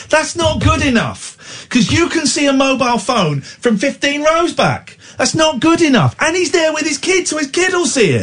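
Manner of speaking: high-pitched outraged scolding; worked-up, high indignation; indignant rant. Real, not acted. Indignant rant about phone in cinema.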